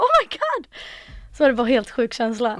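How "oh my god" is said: very high, loud ecstatic squeal; pure overwhelming joy. Retold or imitated. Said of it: "jag bara". (extatiskt, väldigt ljust skrik)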